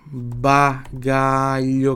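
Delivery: Bagalho stretched very slowly, gl as lh; very slow demo. Shown as a demonstration. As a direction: draw Bagalho out. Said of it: "G L I tem som de L H I". (Bagalho muito arrastado)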